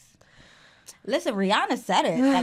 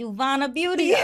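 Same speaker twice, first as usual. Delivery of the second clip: ordinary talk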